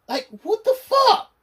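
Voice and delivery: shrieks it high and loud in disbelief; a real angry high-pitched yelp of disbelief; incredulous outburst. Real, not acted. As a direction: explode in furious disbelief. Ranting at absurd Doctor Who plot.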